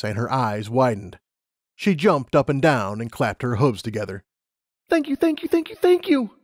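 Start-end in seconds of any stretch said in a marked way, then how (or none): 4.9-6.4 very high, gushing excited mare; overjoyed thanks. Acted. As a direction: bubble over with joy. Lumina jumps and claps.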